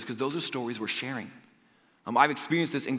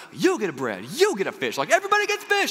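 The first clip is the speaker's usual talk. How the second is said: playful Oprah giveaway shout, climbing much higher at the end; 7 semitones higher, 4 dB louder than usual. Retold or imitated. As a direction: hand out bread like a TV host giving away prizes. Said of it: "like, Oprah style was like".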